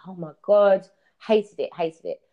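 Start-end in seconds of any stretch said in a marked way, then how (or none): 0.1-0.9 retold alarmed cry. Retelling panic on galloping camel.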